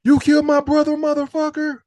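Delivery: high, loud incredulous yell; mock outraged shout. Retelling the movie's revenge reveal, high outraged yell. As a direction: scream the disbelief.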